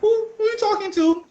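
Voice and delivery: high, exasperated retold questioning; acting out a jealous, high-pitched interrogation; pissed off. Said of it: "I'm getting pissed".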